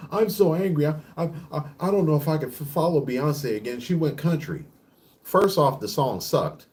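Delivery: hammed-up fan pout; mock upset fan. Mocking an upset [name] fan.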